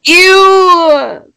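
high, loud "ew" of disgust; disgusted squeal. Real, not acted. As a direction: shriek in disgust. Reacting to sandcastle joke.

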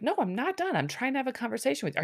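a mock frustrated outburst; exasperated protest. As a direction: rush it out in frustration. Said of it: "And he's like".

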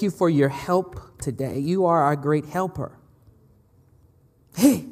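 prayer. Real, not acted. Leads a prayer.

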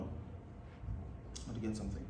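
muttered off to the side, slow and hushed; an unvoiced murmur away from the mic; quiet aside. Real, not acted. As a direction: drop to a half-whispered aside while searching. Aside while fetching something off-mic.

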